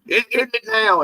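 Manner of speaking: high-pitched imitation with a stammered start; Kevin Talbot. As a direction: do a failed celebrity impression, pitched well up. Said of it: "I try to do Kevin Talbot".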